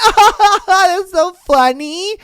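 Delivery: high, loud mock-gushing girl; fawning girl. Girl charmed by a Chad's gross line, gushing in a high mock voice.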